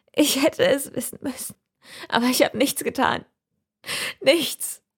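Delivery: the mother, near tears, blaming herself; tear-choked. Acted. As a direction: push the words out through tears. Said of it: "mit Tränen erstickter Stimme".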